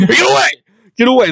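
high-pitched comic alarm; high hammed-up panic; mock panicked cry. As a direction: call it out as if fleeing a monster. Acting out fleeing, calling out.

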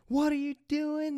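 shrill imitation of an alarmed instructor; teacher yelling. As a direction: squeal it like the frantic driving teacher. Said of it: "You know, yelling at me".

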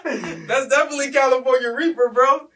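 laughing through the words; giggling while talking. Real, not acted. Laughing after tasting.

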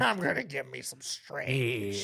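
put-on slow drawn-out old timer; lightly voiced old prospector. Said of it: "I sound like an old prospector".

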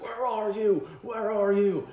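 high, loud searching yell; drunk calling out. Retold or imitated. Drunk coming back around the corner yelling. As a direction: call out for him down the street. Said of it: "And he's like".

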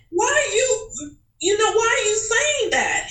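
high-pitched mock indignation; retold pearl-clutching protest; scandalized disbelief. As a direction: squeal it, affronted and disbelieving. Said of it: "I started to clutch my pearls".

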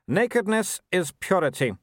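lightly voiced young werewolf noble, cool; a hint of the arrogant son; Wulf's voice. A narrator's reading. Son answering his mother, lightly voiced young noble.

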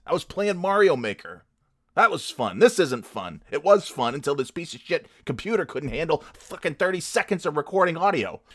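high-pitched, whining frustration; exasperated rant. Real, not acted. Podcaster blaming the computer for spoiled fun, exasperated. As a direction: complain bitterly, voice riding up.

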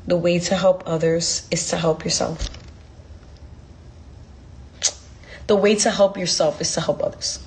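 calm answering voice, lightly done; inner voice. Retold or imitated. The voice she heard while praying, lightly voiced and hushed. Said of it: "And I heard".

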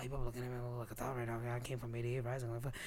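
low, hushed, rushed self-talk; muttered inner panic. Retold or imitated.

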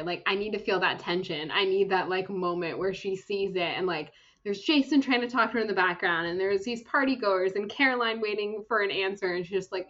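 ordinary talk, nothing marked

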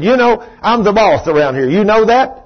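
loud, high, domineering boss. Retold or imitated. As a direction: pull rank in an acted angry outburst. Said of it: "And I'm raising my voice".

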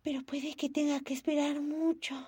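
a hint of a weary little girl; sighing. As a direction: sigh before speaking, resigned. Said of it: "dijo Momo con un suspiro".

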